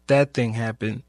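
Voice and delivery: ordinary delivery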